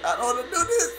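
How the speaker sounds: nervous voice climbing up; heart racing. Real, not acted. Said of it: "Oh, my heart is racing".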